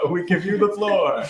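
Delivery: laughing while handing over. Real, not acted. Laughing at the sing request.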